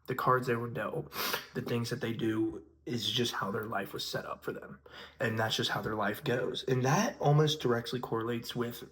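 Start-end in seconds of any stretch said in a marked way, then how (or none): none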